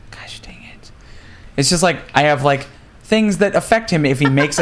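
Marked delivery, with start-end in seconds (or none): 0.1-0.9 whispered frustrated curse; whispering. Real, not acted. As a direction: hiss it out, frustrated.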